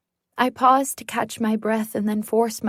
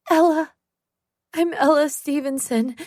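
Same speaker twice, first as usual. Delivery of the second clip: shaken; 5 semitones higher than usual. Acted. Frightened girl answers officer.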